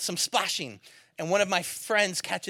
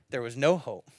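ordinary delivery

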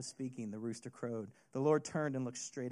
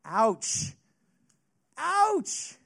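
ouch; 10 semitones higher, 10 dB louder than usual. Pretend. Preacher reacts to Peter's shame.

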